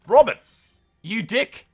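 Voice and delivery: sudden loud outburst at Robert; angry yell. Real, not acted. Gamer yelling at Robert's alliance, angry shout. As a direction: yell it at the screen, furious.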